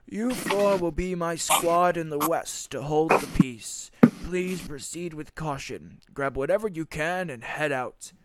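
a hint of a solemn old king sending his squad west. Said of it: "the king says".

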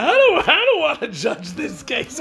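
high, slow, hammed-up nervous whine; mock scared judge. Judge afraid of the tough guy, mock frightened whine. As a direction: play a judge backing away from the case.

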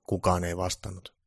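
ordinary delivery, nothing marked

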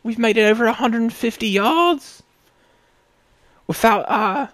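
very high, loud hammed-up British inventor; British accent. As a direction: shriek with giddy British pride. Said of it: "they're British in this scenario".

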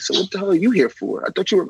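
a touch of mock disbelief; exasperated retort. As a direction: throw it back at her. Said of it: "I'm like".

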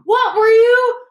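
very high, loud, slow demand; shocked outcry. A narrator's reading. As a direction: let disbelief push the voice right up. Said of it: "he gasped".

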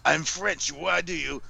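high-pitched, hammy bad French accent; terrible French accent. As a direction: do an outrageous French accent, badly and proudly. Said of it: "I'm gonna do a terrible job at it".

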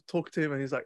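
ordinary talk, nothing marked

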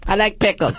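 high, chirpy doll line; pull string toy. Pretend. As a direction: say it like a talking doll. Snooki doll phrase.

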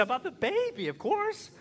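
very high, rising of course; exasperated wife. Retold or imitated. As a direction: she can't believe he asks. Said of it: "She said".

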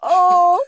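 high, loud wail after laughing. Real, not acted. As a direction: let out a loud helpless moan of laughter. Recovering from a laughing fit.

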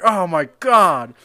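high, loud, slow pretend outrage; loud dramatic whine; mock entitled customer. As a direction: ham up a diva customer at an ice cream shop. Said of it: "and being like".

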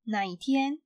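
usual speaking voice

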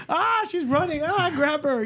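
high-pitched mock alarm; panicked parent. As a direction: cry out in a playful panic. Acted frantic parent reacting to child.

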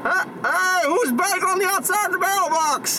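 high muffled calls from inside; calling out. Acted. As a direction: shout out from inside a box. Yelling from inside mailbox.

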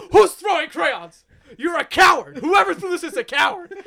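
high, loud, hammed-up yelling; indignant shouted impression of a teacher; mock teacher outburst. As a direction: imitate a teacher losing it at the class. Enraged teacher yells at hidden thrower.

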